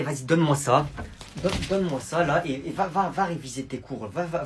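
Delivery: ordinary delivery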